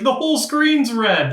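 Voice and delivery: high-pitched frantic calling out to teammates; imitated panicked yell. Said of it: "like, yelling".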